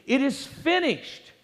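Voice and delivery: high, drawn-out proclamation without shouting; retold cry of victory, held back in volume; triumphant cry. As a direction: proclaim it slowly in triumph, pitched well up. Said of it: "he cried out triumphantly".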